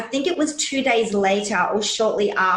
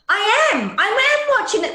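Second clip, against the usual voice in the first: much higher and a bit louder, defensive mum; defensive insistence; 13 semitones higher, 3 dB louder than usual. Retold or imitated. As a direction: insist you are watching, high and pushed. Retold defensive reply to kids.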